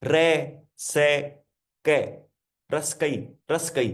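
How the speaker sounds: "रे, से, कै" said as separate syllables, then "रसकई" run slowly together as one word; reading backwards. Shown as a demonstration. Coach showing viewers how to read the word backwards, slowly. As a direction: sound out the reversed syllables, then join them into "रसकई". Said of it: "पीछे से पढ़ना start कर दो इसको".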